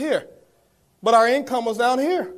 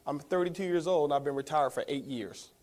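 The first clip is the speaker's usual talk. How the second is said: calm, easy and much softer; 7 semitones lower, 8 dB quieter than usual. Retold or imitated. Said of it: "I heard this calm and easy".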